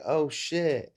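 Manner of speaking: mock startled exclamation; retold alarm. Fighter recalls walking into a street brawl, startled.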